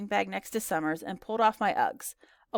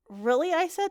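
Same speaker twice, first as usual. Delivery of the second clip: high, wondering question; wowed girl; impressed; 9 semitones higher, slower than usual. Acted. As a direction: react with keen interest. Girl impressed by friend's birthplace.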